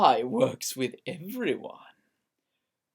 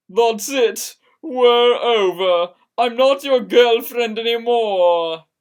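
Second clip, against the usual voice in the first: furious; 5 semitones higher, 11 dB louder than usual. A narrator's reading. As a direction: end it, heartbroken and angry. Girlfriend breaks up, storms off.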